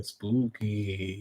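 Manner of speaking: very low, soft spooky word; spooky whisper. Pretend. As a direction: breathe it like a ghost.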